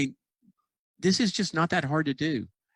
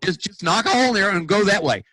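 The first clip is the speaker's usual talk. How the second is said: forceful urging; 6 semitones higher than usual. Retold or imitated. Urging someone out of the maze.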